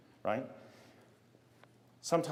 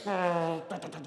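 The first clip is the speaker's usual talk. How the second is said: imitated warplane noises; 6 semitones higher than usual. Kid pretending a big battle with airplanes, imitated noises. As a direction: make toy airplane battle sounds.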